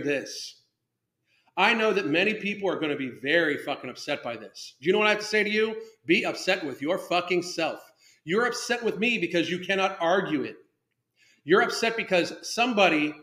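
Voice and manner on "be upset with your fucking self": ordinary talk, nothing marked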